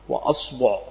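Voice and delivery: أصبع with fatha on the hamza and damma on the ba, aṣbuʿ; vowel demo. Shown as a demonstration. Teacher listing the variant for students, careful aṣbuʿ. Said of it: "فتقول بفتح الهمزة".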